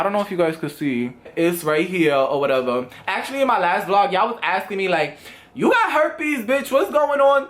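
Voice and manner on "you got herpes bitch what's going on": high mocking voice of a commenter; a jeering commenter, pitched up; mock viewer. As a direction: toss off a snarky viewer question.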